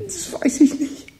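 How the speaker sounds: very high, slow, breathy and breaking; breaking, lost. Acted. The boy answers Tom, voice cracking with fear. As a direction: let the voice crack on a helpless answer. Boy asked where he'll go.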